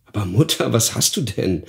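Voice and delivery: ordinary delivery, nothing marked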